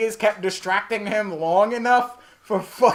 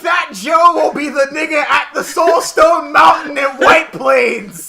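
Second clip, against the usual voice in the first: loud, high, hyped yell; excited shouting; 8 semitones higher, 12 dB louder, slower than usual. Real, not acted. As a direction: hype it up at full volume.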